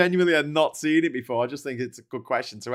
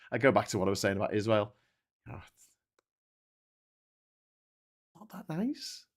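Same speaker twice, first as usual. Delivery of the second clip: usual speaking voice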